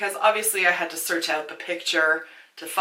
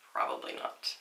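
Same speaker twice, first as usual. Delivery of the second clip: unvoiced, much lower and softer; whispered aside; 13 dB quieter than usual. Real, not acted. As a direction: breathe it as a resigned whisper. Self answer, near silent.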